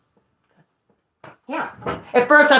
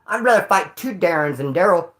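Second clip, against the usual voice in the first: ordinary talk, nothing marked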